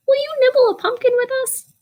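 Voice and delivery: storyteller voicing a small mouse, high; little mouse voice. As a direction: ask like a tiny eager mouse. Mouse speaks in picture book.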